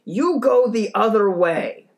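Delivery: imitating a neighbor screaming an order; mock scream. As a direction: yell it in his face. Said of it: "screams in my face".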